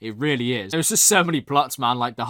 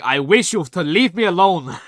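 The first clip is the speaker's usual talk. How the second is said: Londo impression; 4 semitones higher, 5 dB louder than usual. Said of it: "Londo was saying".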